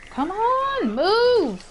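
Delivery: high, drawn-out yell of exasperation; frustrated yelling. Real, not acted. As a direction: yell at the game, stretching each word. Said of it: "I'm yelling".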